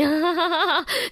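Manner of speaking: high bright burst of laughter; delighted laugh. Real, not acted. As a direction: laugh out the joy of the prank. Happy after the surprise.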